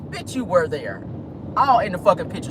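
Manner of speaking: ordinary talk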